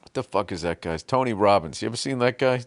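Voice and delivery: usual speaking voice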